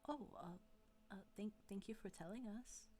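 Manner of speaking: lightly voiced bashful boy; shy fishman. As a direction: stammer it out timidly.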